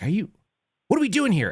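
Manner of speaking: high, incredulous what are we doing here; pushing back at the kid on his phone, exasperated; exasperated challenge. Retold or imitated. A touch of retold outrage. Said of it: "I was really pissed".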